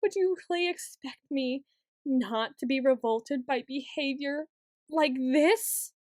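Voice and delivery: ordinary talk, nothing marked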